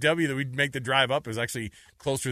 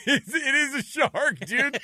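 laughing excited; 13 semitones higher, 4 dB louder, much slower than usual. Real, not acted. Laughing at the mascot.

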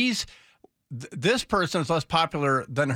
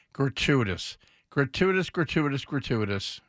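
mock the word gratuitous, trailing off; repeated buzzword; 3 semitones lower than usual. Shown as a demonstration. Host mocking the talking point, fading repeats. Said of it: "the phrase that pays".

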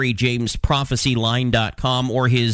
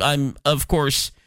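ordinary delivery

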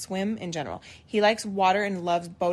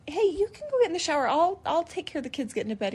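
mock sweet coaxing; 8 semitones higher, faster than usual. Sweetly urging husband to shower.

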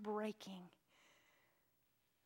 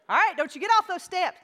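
higher, louder scolding mother; stern mom; 9 semitones higher, 13 dB louder than usual. Retold or imitated. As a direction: warn the child firmly. Mother warning daughter.

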